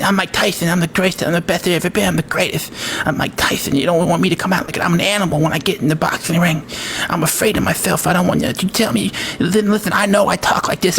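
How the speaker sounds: put-on high-voiced champion, tumbling out brags; Mike Tyson. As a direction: boast rapid-fire in a high lisp. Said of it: "I can do a good Mike Tyson".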